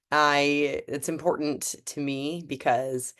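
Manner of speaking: normal talking voice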